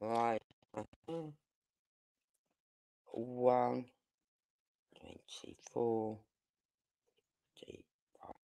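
very soft, low, slow self-talk; barely voiced note to self; talking to oneself. Real, not acted. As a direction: read the time quietly to yourself while noting it. Noting the timestamp to herself.

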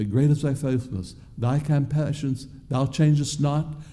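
normal talking voice

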